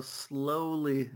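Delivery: SLOWLY drawn right out; emphasis on slowly. Real, not acted. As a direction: stretch out the word slowly to stress it. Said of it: "and I do emphasize slowly".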